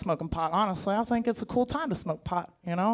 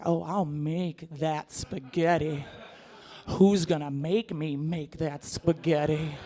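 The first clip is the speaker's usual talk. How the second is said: slow sultry tease; 4 semitones lower, 3 dB quieter, much slower than usual. Retold or imitated. Wife slow suggestive.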